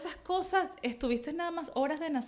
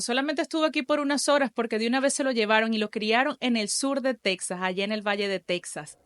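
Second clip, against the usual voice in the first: a touch louder, upbeat host intro; podcast intro read; 5 dB louder than usual. Acted. Host welcoming listeners and introducing her guest, announcer read. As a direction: open the show like a radio host. Host's show intro before interview.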